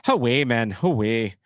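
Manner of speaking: a quick attempt at a Geordie "away, man"; brief Geordie try; Geordie accent. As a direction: toss off a Geordie phrase, lightly. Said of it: "Kane thinks I sound more Geordie".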